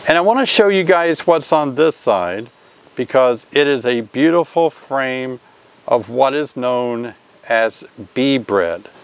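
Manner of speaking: ordinary talk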